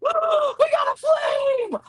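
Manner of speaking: very high-pitched excited squeal; screaming excitement. Retold or imitated. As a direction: scream it out in delight. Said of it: "I'm screaming".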